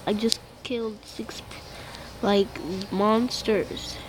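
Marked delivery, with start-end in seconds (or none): 0.0-1.4 unvoiced, much softer whisper. Real, not acted. Gamer murmuring to viewers about kills, whispered. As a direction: breathe it out as a secret aside. Quiet aside after killing monsters.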